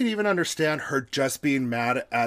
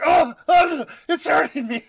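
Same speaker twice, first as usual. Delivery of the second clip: mock pained whine; 12 semitones higher, 9 dB louder than usual. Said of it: "you're like".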